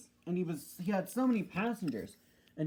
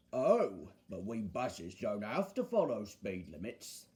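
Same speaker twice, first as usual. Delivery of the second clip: low bus character, boastful; Bulgy; 8 semitones lower, 4 dB quieter than usual. Acted. Bulgy to the engines about speed limits, low and superior. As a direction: drop low and crow over the engines. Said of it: "said Bulgy".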